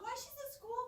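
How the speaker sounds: ordinary talk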